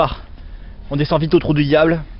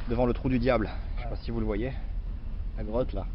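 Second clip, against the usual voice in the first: hushed narration; 3 semitones lower, 12 dB quieter than usual. Acted. Hushed narration entering the cave.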